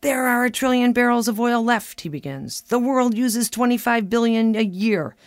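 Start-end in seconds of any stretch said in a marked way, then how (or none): 0.0-2.0 louder, higher mock-fanatical lecturing; imitated fanatic mode. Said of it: "he's in fanatic mode again". 2.6-5.2 high, loud husband on a roll; imitated fanatical lecturing. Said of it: "he's off and running".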